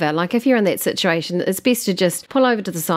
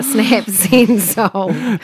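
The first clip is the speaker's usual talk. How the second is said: "Snap, Send, Solve" drawn out slowly, word by word; deliberately slower; 3 semitones higher, 3 dB louder, much slower than usual. Shown as a demonstration. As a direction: playfully overdo a slow, careful "Snap, Send, Solve". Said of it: "I need to say it slower".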